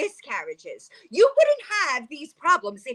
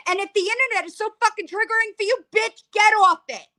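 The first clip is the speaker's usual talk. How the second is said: angry outburst; 7 semitones higher, faster than usual. Real, not acted. Telling Gabby to get off the internet, rising angry shout. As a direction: build into a furious yell.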